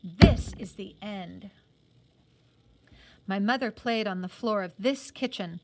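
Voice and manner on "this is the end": loud booming echo that slows and fades away; echoing through the house. A narrator's reading. Words echo through the abandoned house, booming then fading. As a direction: let the words ring and die off like an echo. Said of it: "the words echoed through the house".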